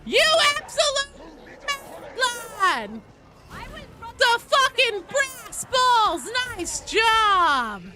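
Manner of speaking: high, very loud shouted praise; yelled at full volume, stretched out and high; hyped cheering. Real, not acted. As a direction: holler congratulations at a teammate. Cheering a teammate's play mid-game.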